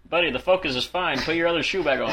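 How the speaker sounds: mock cinema worker. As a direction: talk down to the customer in a silly high voice. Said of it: "Did he say".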